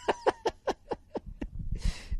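real high-pitched burst of laughter. Real, not acted. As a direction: crack up in a big high laugh. Host cracks up at video.

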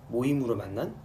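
normal talking voice

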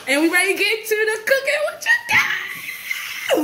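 hyped-up cry to kick off cooking; excited. Real, not acted. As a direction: shout it out, laughing and hyped.

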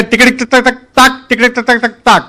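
clicking code-talk imitation; telegraph code chatter. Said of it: "બીજો તાર officer હતો કે".

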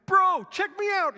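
high, loud gleeful shout to a friend; gleeful call. Retold or imitated. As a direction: show off like a kid in midair. Flying believer calls to a brother.

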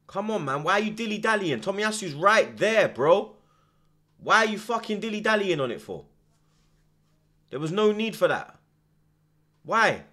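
loud, high exasperated yells at the screen; furious at the player dilly-dallying on the ball; angry yelling. Real, not acted. Fan yelling at a player for dawdling, angry and loud.

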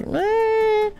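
a high, soft put-on old lady "Eh"; a tiny dismissive old-woman noise; old lady grunt. Streamer imagines the examiner reacting to his video, high soft old lady grunt. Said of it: "she's gonna be like".

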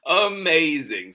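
high, loud exclamation from Mom; exclaimed. Acted. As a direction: exclaim it, lifted and strong. Mom answers, first reading.

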